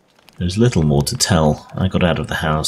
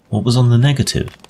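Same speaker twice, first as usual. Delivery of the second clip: eager, curious question pitched up and a bit louder; curious listener pressing the storyteller; with much curiosity; 8 semitones higher, 3 dB louder than usual. A narrator's reading. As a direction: ask it keen to know the answer. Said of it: "I asked with much curiosity".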